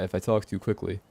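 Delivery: ordinary talk, nothing marked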